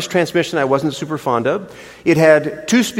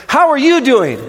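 much higher, louder bright greeting; cheery wife; 9 semitones higher, 5 dB louder than usual. Retold or imitated. Wife hopping in the car, mock cheerful greeting. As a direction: chirp it like sunshine.

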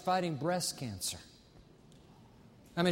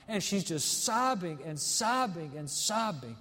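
ordinary delivery

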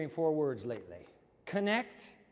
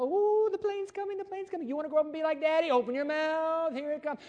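very high, sing-song airplane game; exaggerated high-pitched baby talk; mama feeding baby; 11 semitones higher, 5 dB louder than usual. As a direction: coo it high like a parent flying the spoon in. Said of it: "your mama doing this".

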